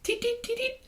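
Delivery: very high chirping teet; piping sparrow cheeps. Acted. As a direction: chirp it like a tiny bird. Sparrow calls out at boy.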